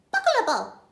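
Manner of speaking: put-on very high, babbling baby. Baby repeats the burglar's name, babbling. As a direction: squeak it like a toddler. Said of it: "says the baby".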